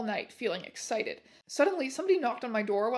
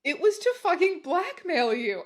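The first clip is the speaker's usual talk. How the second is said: higher and louder, smug and biting; snarky; 6 semitones higher, 5 dB louder than usual. Acted. Heroine blackmailing the boys with a photo, snarky. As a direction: deliver it with a smug sneer. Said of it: "I snarked".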